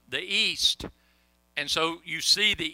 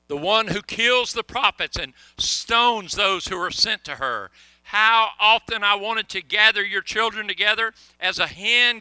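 lifted, louder lament read as Jesus; preaching scripture; 3 semitones higher, 4 dB louder than usual. Acted. Preacher reading Jesus' words over Jerusalem to congregation, impassioned. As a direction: proclaim it like a grieving prophet.

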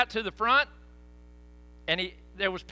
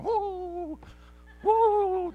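imitated woo; 11 semitones higher than usual. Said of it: "Brother Kim was going".

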